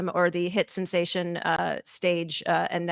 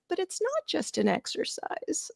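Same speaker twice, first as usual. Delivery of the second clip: small, high, softer plaintive voice; imitating upset protest; 11 semitones higher, 7 dB quieter than usual. Student upset over negotiation exercise, retold plaintive protest. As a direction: protest in a hurt little squeak. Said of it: "I got so upset".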